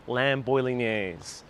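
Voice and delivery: slow, drawn out lamb bolognese; dreamy lamb bolognese; savoring the words. Real, not acted. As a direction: linger on lamb bolognese like a treat. Savoring the dish name.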